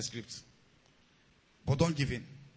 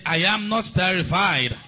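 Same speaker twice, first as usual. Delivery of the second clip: slow, louder congregation chant; loud declaration; 8 dB louder, slower than usual. Shown as a demonstration. Preacher leading the congregation in a slow loud declaration. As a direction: declare it slowly with force.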